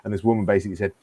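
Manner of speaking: usual speaking voice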